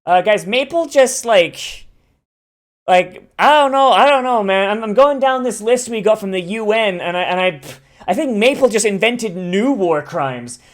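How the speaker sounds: panicked game dev reporting to his team; nervous dev. Retold or imitated. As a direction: sound like a worried dev reading out a list. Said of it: "this one guy's like".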